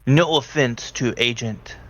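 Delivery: ordinary delivery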